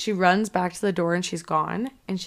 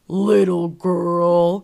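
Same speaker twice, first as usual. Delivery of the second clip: creepy voice; 7 dB louder, much slower than usual. Retold or imitated. Retelling the movie's scene, slow and menacing. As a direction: draw it out with menace. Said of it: "and she goes".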